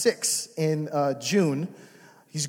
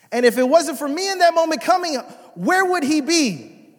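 loud, high, impassioned preaching; 10 semitones higher, 6 dB louder than usual. Acted. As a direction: preach it hard and build. Preaching at full force.

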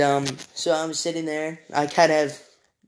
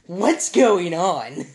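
drawn-out, higher baffled question; acted-out disbelief, slow and raised; baffled reaction; 4 semitones higher, 4 dB louder, much slower than usual. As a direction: stretch it out in mock bewilderment. Retells his own baffled reaction.

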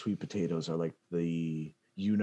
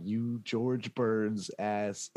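slow drawl; 2 semitones higher, much slower than usual. Retold or imitated. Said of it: "I'm like".

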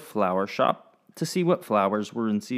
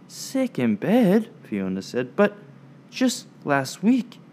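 surprised; 6 semitones higher than usual. Acted. Fiona stunned by the news.

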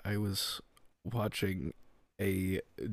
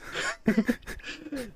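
sudden high-pitched laughter; 3 dB louder than usual. Real, not acted. Laughs at awkward question.

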